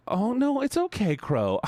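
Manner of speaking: very high cooing comfort; high-pitched reassurance. Real, not acted. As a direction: reassure them in a high, sympathetic coo. Reassuring a chatter.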